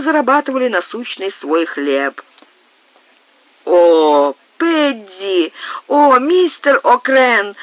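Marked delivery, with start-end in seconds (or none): 3.7-7.6 high, slow, drawn-out cry for the captain; a lady squeezed in the crowd calls to her escort, higher and slow; lady calling out. A narrator's reading. Lady in a crush calling the captain, high and drawn out. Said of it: "послышался голос дамы капитана".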